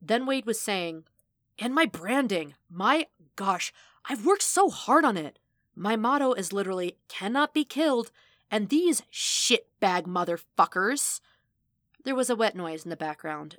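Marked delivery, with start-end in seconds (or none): 1.6-11.2 indignant rant. A narrator's reading. Wade ranting indignantly.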